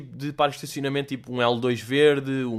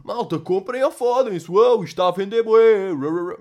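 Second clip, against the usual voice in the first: much higher and louder, hammed-up salesman; mock hype pitch; 6 semitones higher, 7 dB louder than usual. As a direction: oversell like a shameless promoter. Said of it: "aquele tipo de bluff". (vendedor exagerado, alto e agudo)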